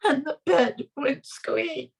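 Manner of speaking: ordinary delivery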